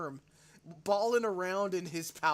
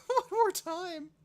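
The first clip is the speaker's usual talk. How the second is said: much higher, louder, slow and giddy; giddy high request; 12 semitones higher, 6 dB louder than usual. Real, not acted. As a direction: beg for a replay, still giddy. Asks to replay, still laughing.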